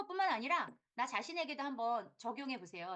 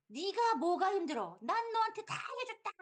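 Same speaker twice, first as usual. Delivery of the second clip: resentful mother; 9 semitones higher, 5 dB louder than usual. Retold or imitated. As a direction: scold the grown son. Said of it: "이렇게 얘기하잖아요". (서운한 엄마가 아들을 나무라듯 높게)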